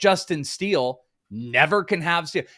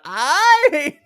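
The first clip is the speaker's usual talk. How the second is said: mock strained squeak, much higher and louder, breaking into a laugh; voice cracking; 13 semitones higher, 7 dB louder than usual. Teasing Joe over his griping about the draft, cracking high squeak. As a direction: crack the voice mid-complaint like it gave out. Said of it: "you lost your voice there a little bit".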